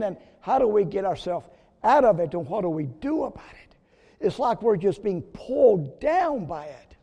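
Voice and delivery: loud, high, driving sermon; preaching at full force. Acted. Pastor urging the congregation about sin, loud preaching. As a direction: press it home to the church.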